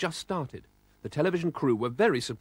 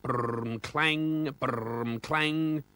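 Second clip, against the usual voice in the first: engine noise; much slower than usual. A narrator's reading.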